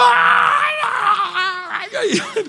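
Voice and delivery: high, loud laughing wails; mock the boy's screaming; screaming kid. Son screaming on the drop, imitated with laughter. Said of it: "Él gritando".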